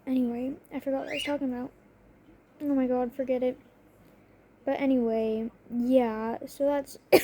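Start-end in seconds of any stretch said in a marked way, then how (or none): none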